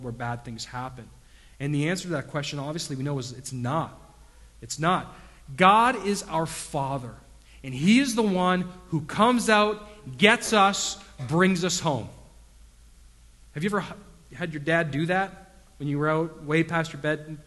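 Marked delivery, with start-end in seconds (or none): 5.6-12.1 loud, higher fervent proclamation; preaching at full force. Acted. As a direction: proclaim it with fire. Preaching climax.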